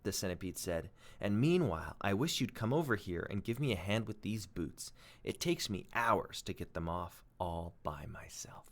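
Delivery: expressive read-aloud storytelling; story narration. A narrator's reading. Teacher reading a chapter aloud to pupils, expressive storytelling.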